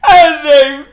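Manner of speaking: high, loud, drawn-out sobs; theatrical bawling; sobbing king. A narrator's reading. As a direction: sob it out slowly at the top of the voice. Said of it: "he sobbed".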